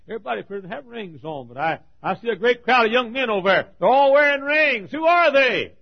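loud and high, rushing, then a ringing question; preaching at full force. Acted. Preacher to his congregation, asking about the ringed men, preaching loudly. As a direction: cry it out over the crowd.

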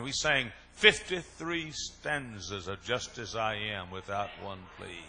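slow drawn-out complaint. Retold or imitated. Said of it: "He said".

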